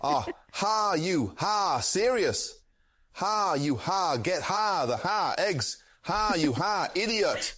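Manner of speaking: Gordon Ramsay with ha. Acted. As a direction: scold like a TV chef, slipping a ha between words. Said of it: "Say, "Ha," after every word".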